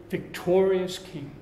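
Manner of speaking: usual speaking voice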